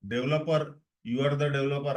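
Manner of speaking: normal talking voice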